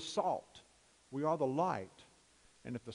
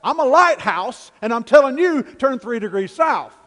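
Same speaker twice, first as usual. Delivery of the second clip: lighthouse answering loudly; 9 semitones higher, 18 dB louder than usual. Retold or imitated. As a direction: answer back unmovable and loud. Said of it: "the voice came back and said".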